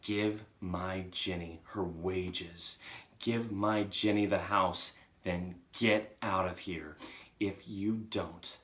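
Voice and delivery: slow, deliberate ghostly orders; ominous command. Acted. Chalk giving the Trunchbull orders, slow and ominous. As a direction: lay down each demand word by word.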